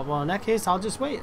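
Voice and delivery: ordinary talk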